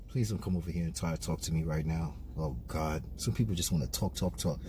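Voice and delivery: low, fast, muttered to himself; annoyed mutter. Real, not acted. Driver muttering about people who want to talk. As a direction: grumble it so nobody hears. Annoyed aside while parking.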